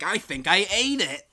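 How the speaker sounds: hammed-up cartoonish admission; acted crew member. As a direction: own up in a put-on voice. Acts Hooter's sheepish confession.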